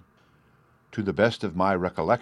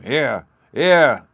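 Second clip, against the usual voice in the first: loud, higher cheer of approval; rumbling crowd roar; 5 semitones higher, 8 dB louder than usual. A narrator's reading. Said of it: "rumbling round the marketplace like a peal of thunder".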